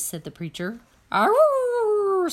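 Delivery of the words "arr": high, loud mournful dog howl. A narrator's reading. Dog howling outside the church during the sermon. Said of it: "said Winn-Dixie back".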